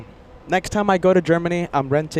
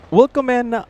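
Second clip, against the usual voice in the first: loud German welcome; 9 semitones higher, 5 dB louder than usual. Real, not acted. Greets viewers in German.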